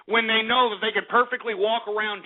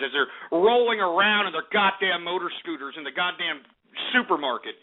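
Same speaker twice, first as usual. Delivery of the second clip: ordinary talk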